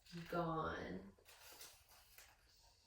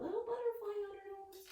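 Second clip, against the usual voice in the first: higher, excited pointing out; delighted aside; 12 semitones higher than usual. Real, not acted. Reader showing the picture to children, higher and delighted.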